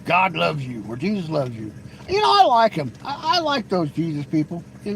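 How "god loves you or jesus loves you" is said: lower, hushed, mock-pious voice; lightly voiced churchy scold; mock preacher. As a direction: streamer mocks Jesus freaks preaching to a mod, hushed and pious. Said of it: "They'll be like".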